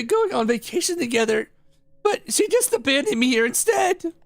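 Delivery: put-on a hurt kid pouring out his story; upset boy.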